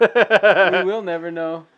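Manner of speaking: slow, laughing echo. Real, not acted. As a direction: drag it out while laughing. Teasing echo through laughter.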